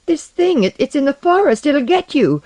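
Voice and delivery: high-pitched frightened chatter; scared children. A narrator's reading. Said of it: "chattered like scared children".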